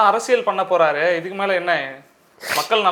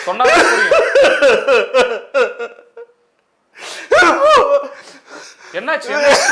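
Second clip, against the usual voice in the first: high squeaky laughing voice, louder; can't hold back the laughter while asking; real giggling through the question; 10 semitones higher, 7 dB louder than usual. Real, not acted. Interviewer cracks up at crying guest.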